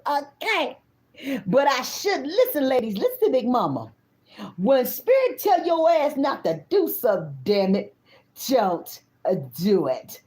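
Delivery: ordinary delivery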